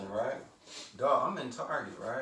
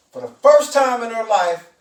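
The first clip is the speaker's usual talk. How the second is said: much louder and higher, building to the payoff; fired-up punchline; 5 semitones higher, 12 dB louder than usual. Real, not acted. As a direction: hit it like the big reveal. Heated punchline about the manager.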